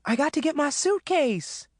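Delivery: very high, urgent voice; frantic reply. A narrator's reading. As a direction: call back in a fluster. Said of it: "Maureen replied".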